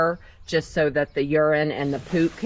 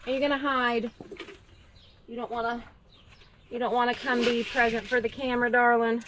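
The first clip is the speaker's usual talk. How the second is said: cooing to a pet; 5 semitones higher, 4 dB quieter than usual. Real, not acted. Coaxing a shy rabbit.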